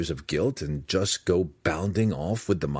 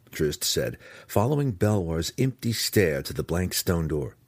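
plain reading voice

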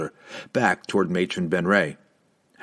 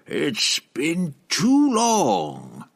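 slow, high, mocking witch; teasing; 7 semitones higher, much slower than usual. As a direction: tease him, drawn out and sly. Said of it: "Benray teased".

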